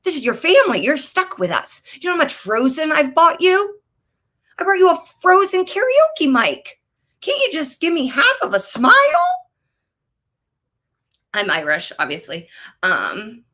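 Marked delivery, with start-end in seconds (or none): 0.0-8.9 high, exasperated pleading to the niece; pleading rant. Retold or imitated. Aunt pleading with her niece on Zoom, high exasperation. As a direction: beg for a smile, voice pitched up. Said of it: "It's like".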